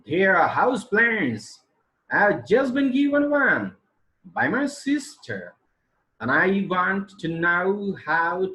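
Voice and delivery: acted textbook dialogue; practice dialogue reading. Shown as a demonstration. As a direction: read the dialogue with clear intonation. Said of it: "I read it just for practice".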